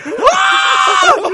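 high shrill mock scream; retold scream. Host reenacting screaming alone at the movie, high scream. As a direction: let out a short startled scream. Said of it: "I was just screaming".

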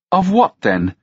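high, pointed question; demanding. A narrator's reading. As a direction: demand an answer, pitch jumping on the first words. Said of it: "she demanded".

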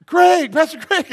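high, loud mock yell; calling to wake someone. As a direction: call out urgently to rouse a sleeper. Imitates people trying to wake him.

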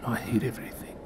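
whispered mock sulk; whispering. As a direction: whisper it like her sulking. Imagines her muttering.

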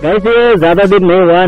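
ordinary talk, nothing marked

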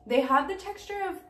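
speaking between chews; talking while chewing candy. Real, not acted. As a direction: mouth partly full of candy. Seen 1: Candy eaten just before; chewing-like mouth, holds candy pieces while talking.

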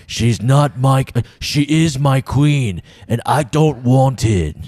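slow, brooding Jon Snow; Jon Snow impression. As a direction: drag out each word, solemn and grave. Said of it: "gets paid half a million an episode to say".

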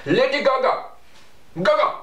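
high-pitched mock Indian accent. Shown as a demonstration. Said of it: "with an India people accent".

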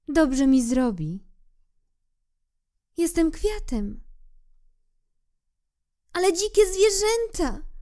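proud rose. Acted.

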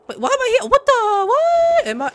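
high, loud sudden bewilderment; startled confusion. Real, not acted. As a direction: yelp as if suddenly teleported. Streamer confused by teleport.

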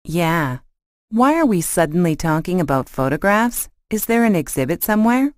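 ordinary talk

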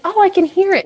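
high, loud mock exclamation; imitated listeners' reaction. Quoting people who notice her Midwest accent, high loud mimicry. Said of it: "people will be like".

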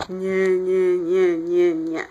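low monotone robot chant. Retold or imitated. Clark imitating how the Kryptonian talks, robot voice. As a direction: drone it out like a machine, on one low note. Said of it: "He talks like a robot".

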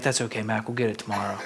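soft consoling friend. Retold or imitated. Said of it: "He's like".

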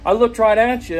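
high, loud accusing stepmother. Retold or imitated. Quoting the stepmother's accusation on the phone, high and loud. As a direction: throw the accusation up high like her. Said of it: "She said".

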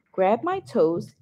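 ordinary delivery

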